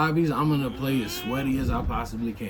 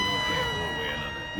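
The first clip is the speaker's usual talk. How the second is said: mock falsetto; 7 dB quieter than usual. Sudden squeaky falsetto line.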